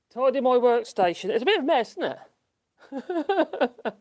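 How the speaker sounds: high, loud mock scolding; playful teasing. Real, not acted. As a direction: tease the animal about the mess. Teasing chat to the animal.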